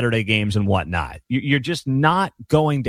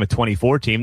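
ordinary talk, nothing marked

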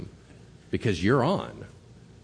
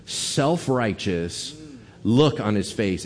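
usual speaking voice

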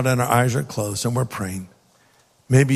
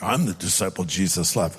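boastful; 8 semitones lower, 4 dB quieter than usual. Pretend. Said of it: "might sound boastful".

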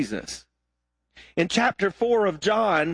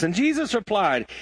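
usual speaking voice

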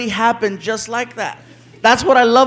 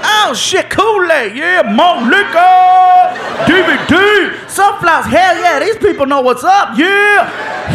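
excited whooping; 7 semitones higher, slower than usual. Retold or imitated. Guest unpacking the gift basket, whooping with glee. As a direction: whoop over every item like a kid at Christmas.

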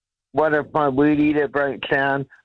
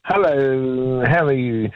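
sing-songy. Real, not acted. Caller greeting the radio host on air, sing-songy. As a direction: greet with long, lilting vowels. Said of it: "Kind of sing-songy there".

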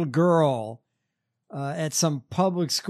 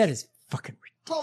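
whisper; 4 semitones higher, 5 dB quieter, much faster than usual. Real, not acted. Aside reacting to Musk news.

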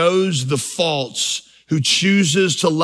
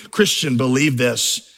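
normal talking voice

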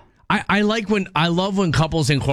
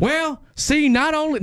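much higher twangy star voice; hammed-up country singer imitation; [name] impression; 13 semitones higher, slower than usual. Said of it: "I'm doing [name]".

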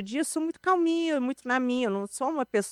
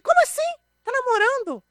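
high-pitched incredulous squeak, a bit louder; shocked disbelief; 4 dB louder than usual. Retold or imitated. Telling the interviewer how she reacted to the friend's news, with high incredulous disbelief. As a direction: replay your own stunned reaction, way up high.

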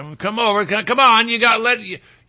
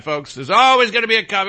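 ordinary delivery

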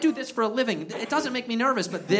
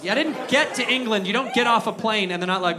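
ordinary delivery

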